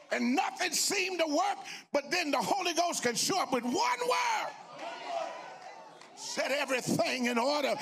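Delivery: rousing shouted sermon; full-force preaching. Real, not acted. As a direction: build to a high, shouted proclamation.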